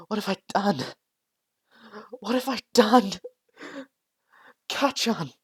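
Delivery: high, loud anguished crying; horrified cry. Acted. Deku over Bakugo's body, crying high and loud. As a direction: cry out in horror, breaking down.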